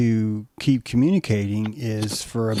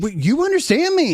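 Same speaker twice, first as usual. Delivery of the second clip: much higher, louder amazed ask; astonished question; 4 dB louder than usual. Retold or imitated. Bird in disbelief at being understood, high amazement.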